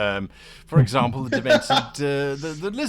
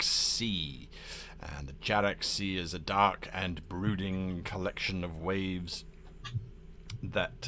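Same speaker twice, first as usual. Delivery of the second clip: game master scene setting; 6 semitones lower than usual. Acted. Game master describes a dark ocean to players, low brooding narration. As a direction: open grandly, then settle into low ominous description.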